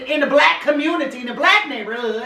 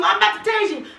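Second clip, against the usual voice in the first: mocking officer; 8 semitones higher than usual. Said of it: "mocking the officer".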